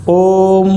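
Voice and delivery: ओम held for three beats, vowel stretched long; drawn-out pluta vowel. Shown as a demonstration. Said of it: "जो बोलने में तीन गुना समय लें".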